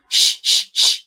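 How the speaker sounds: "Sh" hissed with no voice, as in sheep; sh sound alone. Shown as a demonstration. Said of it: "a she in sheep".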